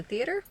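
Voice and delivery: "theater." lifted into a question-mark rise; rising like a question. Real, not acted. Texting her friend, "theater." rising like a question. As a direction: end "theater." going up as if asking. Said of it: "like, a question mark".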